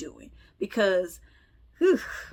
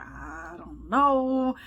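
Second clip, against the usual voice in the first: clueless shrug; 5 semitones higher, 4 dB louder, much slower than usual. Retold or imitated. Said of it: "He's like".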